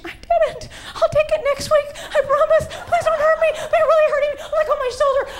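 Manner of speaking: high laughing whimper begging for mercy; mock pleading. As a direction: plead in a high teary whine through laughter. Pleading with the physical therapist.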